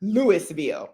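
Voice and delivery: Louisville said LOO-is-vil with the s; outsider's Louisville with a hard s; wrong pronunciation. Shown as a demonstration. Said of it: "Don't say that".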